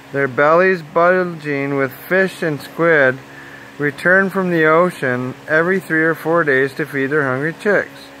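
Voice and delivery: ordinary delivery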